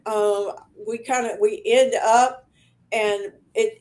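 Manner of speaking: ordinary talk, nothing marked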